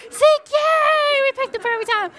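high, loud gleeful yay; cheering Zeke for free entry. Retold or imitated. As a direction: cheer the kid like you won a prize.

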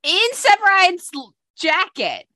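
a friend mocking a clingy character's nerve; mocking disbelief. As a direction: sneer it in a put-on voice.

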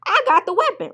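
fast, high, loud mock threat; a hammed-up tough-girl boast about carrying the gun; mock gangster bravado. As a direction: boast like you're ready to shoot, fast and loud. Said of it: "And I'm like".

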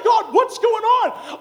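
high, loud, stretched-out cry; frustrated shout. Acted. Worn conference host complains to God, frustrated high shout. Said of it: "Sometimes you feel like".

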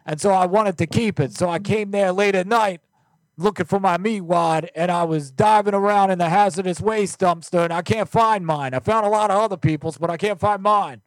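high, loud, pestering caller voice; loud prank caller. Acted. Prank caller pleading for a lost meat wad, high and loud.

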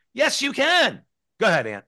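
high-pitched rallying cry; emphatic encouragement. Acted. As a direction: push it out high like a pep talk. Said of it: "And you need to say".

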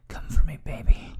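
breathy whispered coaxing; whispering. Acted. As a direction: whisper it close to the ear. Coaxing in intimate scene.

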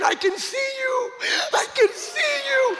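very high, drawn-out wail of wonder; imitated tearful cry. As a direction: cry out as if just given sight. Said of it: "and he's crying".

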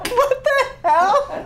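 high, slow, laughing disbelief; shocked laughing what the hell. Retold or imitated. Mother hearing her son call her savage, stunned laugh. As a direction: drag it out in amused shock. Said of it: "And I was like".

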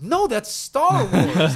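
slow, drawn-out, high and loud insistence; a little kid who won't be told otherwise; stubborn kid. Retold or imitated. A kid insisting to his older brother, slow and stubborn.

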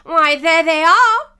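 loud, high cry of triumph; drawn-out, triumphant exclamation. A narrator's reading. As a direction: crow it slowly and loudly. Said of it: "said the king triumphantly".

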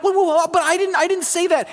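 high pleading protest to the referee; frantic protest. Retold or imitated. Boy protesting to the referee, high frantic pleading. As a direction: beg the ref, voice way up.

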